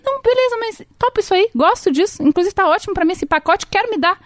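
put-on boyfriend's eager reply. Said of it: "E ele".

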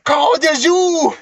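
high, loud drawn-out yell; shouted Billy impression. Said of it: "He's like".

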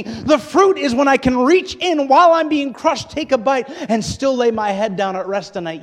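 high, loud, impassioned pulpit shouting; full-force preaching. Real, not acted. Preacher urging tired people to bear fruit at home, full-force preaching. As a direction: drive each phrase home at the top of the voice.

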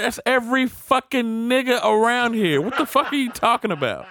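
high-pitched, loud comic rant; worked-up mock indignation; outraged disbelief. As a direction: argue incredulously, voice way up. Retold outrage at vague suspect description.

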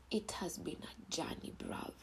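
soft unvoiced whisper; hushed whisper to the listeners. Real, not acted. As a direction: breathe it like a confession. Whispered confession.

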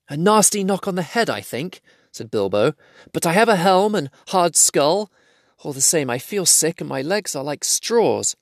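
a bruised hobbit telling the man his head hurts; weary hobbit. A narrator's reading. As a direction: voice Bilbo feeling sick. Bilbo voiced, hurt.

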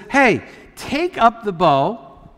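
slow, drawn-out command; stretched-out instruction, acted as the prophet. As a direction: give the order deliberately, word by word.

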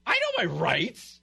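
slow mock entitled passenger; mocking entitled quote. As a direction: drawl the catchphrase smugly. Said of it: "You knew your rights".

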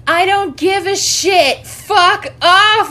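loud, high, drawn-out mock fury; high-pitched, full-volume tirade imitation; angry retold shout. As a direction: shout it slowly, acting out his outburst. Retold angry refusal at window.

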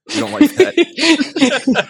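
high, slow, laughing whine; drawn-out giggly complaint. Acted. Joking mock-upset aside.

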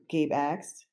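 usual speaking voice

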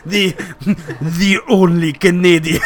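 put-on loud, slow botched French accent; mock French accent. Teasing the friend's attempt, exaggerated French-Russian accent. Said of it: "doing a French accent? But you sound Russian".

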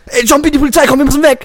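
high, loud panicked warning shout; aufgebracht, nervös. Acted. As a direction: shout a hammed-up alarm to a friend. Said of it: "bist so aufgebracht". (aufgebrachter, hoher Warnruf)

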